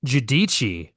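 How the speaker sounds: "Giudici" echoed as joo-DEE-chee; Italian pronunciation repeated. Shown as a demonstration. Host trying out the Italian name after co-host, careful pronunciation. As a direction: try "Giudici" with a chee ending.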